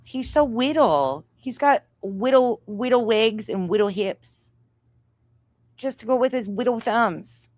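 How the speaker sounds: mock baby-talk, saying whittle for little.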